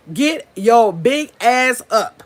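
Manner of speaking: slow, each word hit "Get your big ass up"; slow emphatic channel name. Shown as a demonstration. Plugging her channel to viewers, slow and punchy. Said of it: "channel name chant".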